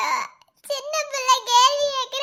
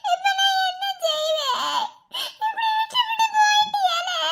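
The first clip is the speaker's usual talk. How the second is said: high, crying lament over the dead husband; wailing sob; 7 semitones higher than usual. Acted. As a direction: wail it out through tears. (கணவனை இழந்து அழும் ஒப்பாரி)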